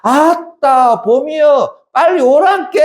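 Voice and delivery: high, drawn-out Jeolla dialect call. Acted. Teacher calls spring for the Jeolla girl, Jeolla dialect. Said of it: "내가 너의 사투리로 불러준다라는 것은".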